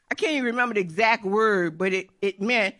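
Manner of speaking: ordinary talk, nothing marked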